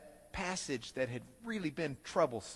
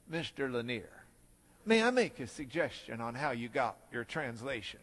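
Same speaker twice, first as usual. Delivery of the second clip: professor voice; 7 semitones lower, 4 dB quieter than usual. Retold or imitated. Professor offers suggestion.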